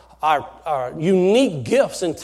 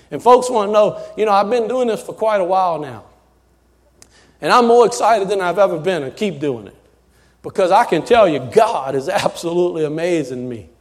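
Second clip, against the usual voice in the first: ordinary delivery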